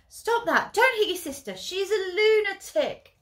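loud, high scolding shout; parents shouting. Acted. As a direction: shout at the fighting kids. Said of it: "shout at the exactly the same time".

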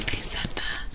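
ordinary talk, nothing marked

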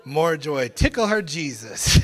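ordinary talk, nothing marked

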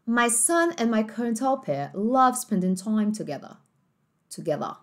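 slow British English, "together" with no r; the line in British, r dropped; British accent. Shown as a demonstration. Teacher reading the full sentence in British accent. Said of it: "nesse sotaque mais britânico".

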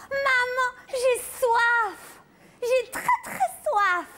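put-on high whiny little girl; child voice. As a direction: whine for a drink like a kid. Child asks for water. (voix de petite fille qui réclame)

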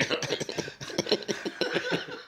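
very high wheezing laugh. Real, not acted. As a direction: squeal with breathless laughter. Laughing at imagined empty restaurant.

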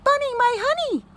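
high, quick singsong call; high-pitched mother's call; mommy rabbit calling. A narrator's reading. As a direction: call out for your lost bunny. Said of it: "He hears his mommy".

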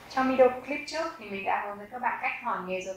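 ordinary delivery

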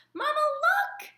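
very high, slow squeaky gosling cry; a little bird calling out in triumph; squeaky and thrilled; squeaked. Acted. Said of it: "he squeaked".

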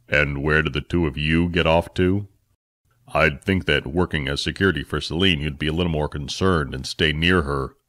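a disapproving father figure reproaching; Phil, scolding parent. Acted. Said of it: "Phil's tone changed from scolding parent".

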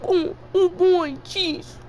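slow, high goofy puppet voice; drawn-out cartoon puppet; Shark Puppet voice. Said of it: "my best Shark Puppet voice".

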